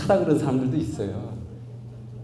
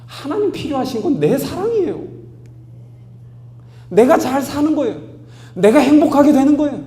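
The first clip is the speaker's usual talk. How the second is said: higher and louder, driving and emphatic; full-force preaching; 10 dB louder than usual. Acted. Pastor rousing congregation, full-force preaching. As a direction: pound the point home to the congregation.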